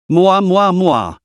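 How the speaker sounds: playful smooching sounds; kiss sounds. A narrator's reading. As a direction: blow teasing kisses down the phone. Blowing kisses down the phone.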